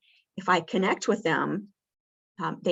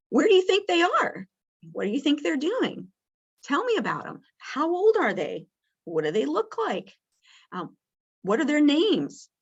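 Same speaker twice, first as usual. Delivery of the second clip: high, eager string of questions; curious questions; 8 semitones higher than usual. Shown as a demonstration. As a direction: sound keen to hear about her kids, pitched up. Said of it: "really ask some questions".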